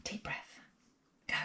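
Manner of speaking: unvoiced hush before the big moment; whispered to herself. Real, not acted. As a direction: whisper it to steady yourself. Steeling herself before opening kiln.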